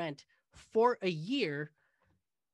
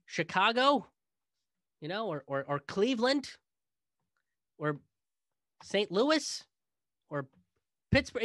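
sarcastic list; 4 semitones higher, 3 dB louder than usual. Acted. Mock-listing cities, each rising higher.

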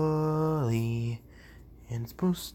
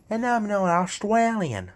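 put-on higher, lightly Australian-accented voice; 9 semitones higher, 4 dB louder than usual. Trying a voice filter for viewers, a light Australian accent. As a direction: play a cheerful Australian through a voice filter. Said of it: "Don't know how I do this voice".

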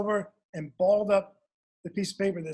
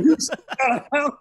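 a raised, louder mock-shocked mother; imitating shocked mom; 8 semitones higher, 7 dB louder, faster than usual. Retelling mom's shock at his sneaking out, mock-shocked. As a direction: play the stunned mom, half-joking. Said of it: "exactly the first thing she said".

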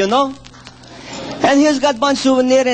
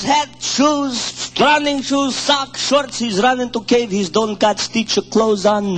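ordinary talk

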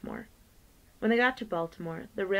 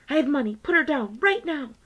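high, loud, frantic demand; shouting; 8 semitones higher, 9 dB louder than usual. Acted. Violet yells at the brakeman to put Myrtle down, frantic shout. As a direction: yell the order at him.

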